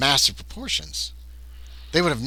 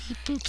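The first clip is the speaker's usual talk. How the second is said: beep boop; 9 dB quieter than usual. Acted. Computer noise sound effect.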